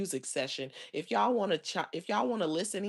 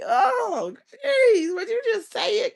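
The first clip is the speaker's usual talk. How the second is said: very high, loud pleading yell; exasperated shout; 11 dB louder, slower than usual. Retold or imitated. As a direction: beg her to just say it. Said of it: "I'm like".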